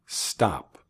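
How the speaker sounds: ordinary talk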